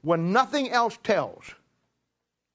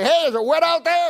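high, loud, sarcastic cheer; mock friendly taunt; 11 semitones higher, 6 dB louder than usual. Driver mocking stranded enemy, mock cheerful taunt. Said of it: "and say".

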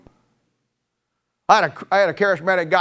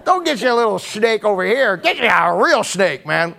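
mock dare; 5 semitones higher than usual. Mocking dare, Dundee-style.

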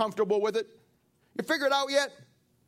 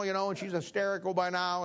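ordinary delivery

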